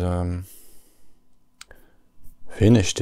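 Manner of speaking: ordinary delivery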